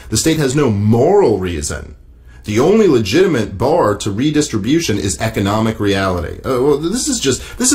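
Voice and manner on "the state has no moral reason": "moral" stretched and stressed above the rest. Shown as a demonstration. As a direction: punch "moral" as the key word. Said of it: "emphasis on moral, his not mine".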